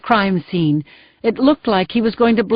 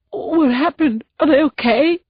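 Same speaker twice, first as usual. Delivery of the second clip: high, crying, desperate questions; choked out; 6 semitones higher than usual. Acted. Grieving husband begging the sergeant for news, crying. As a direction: force the words through tears. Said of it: "Marone choked out".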